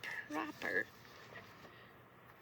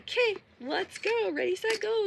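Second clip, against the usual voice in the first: eager; 9 semitones higher, 8 dB louder than usual. Retold or imitated. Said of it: "you're like".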